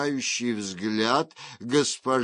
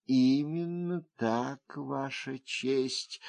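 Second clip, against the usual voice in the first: soft, composed confirmation; measured, level, unhurried reply; even voice; 6 dB quieter, slower than usual. A narrator's reading. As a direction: confirm it calmly, without agitation. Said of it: "ответила она тем же ровным голосом".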